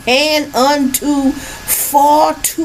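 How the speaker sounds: building from slow and high to a shout; biblical preaching. Acted. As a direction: preach the curses up to a full shout.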